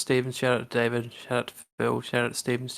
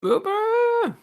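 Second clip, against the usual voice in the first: excited shout; 3 dB louder than usual. Real, not acted.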